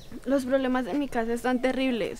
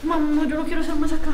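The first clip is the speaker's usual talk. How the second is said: panicked; 3 semitones higher, 6 dB louder, faster than usual. Acted. Panicking in haunted place.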